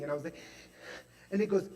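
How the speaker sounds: ordinary talk, nothing marked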